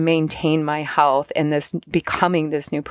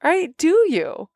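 higher, teasing "do you?"; knowing tease; 10 semitones higher than usual. Retold or imitated. Spirit guides teasing her, mock knowing question. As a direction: gently needle her. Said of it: "they're like".

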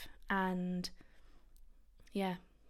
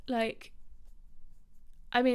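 ordinary delivery

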